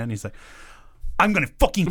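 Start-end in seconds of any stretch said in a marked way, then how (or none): 1.2-1.9 retold threat, pitched up; Sam threatening. Boss phoning his new employee, imitated threatening outburst. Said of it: "he's already threatening".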